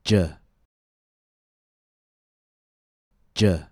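"J" said as an isolated "juh", air stopped then released; "J" as a lone consonant sound for repeating, long pause between; j sound model. Shown as a demonstration. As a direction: say "J" as "juh", tongue tip pressed then quickly released. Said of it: "Please listen and repeat".

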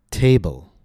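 teacher's model of "table", front-stressed; stress on first syllable. Shown as a demonstration. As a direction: stretch the first vowel of "table", then fall. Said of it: "Notice the stress on the first syllable. Long vowel, higher pitch".

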